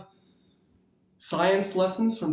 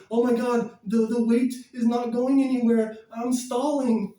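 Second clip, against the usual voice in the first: higher, slightly louder whiny mock panic; mock panicking dieter; 7 semitones higher, 3 dB louder than usual. Mimicking a frustrated dieter, hammed-up whine. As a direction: whine like a dieter stuck on the scale. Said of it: "And don't be".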